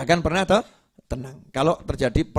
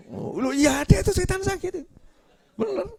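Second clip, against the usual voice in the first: excited agreement. Real, not acted. Menanggapi jamaah dengan semangat.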